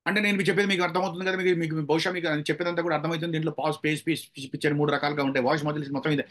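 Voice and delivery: fast run-on delivery without breathing gaps; rushed, no pauses. Shown as a demonstration. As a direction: rattle it off like someone running.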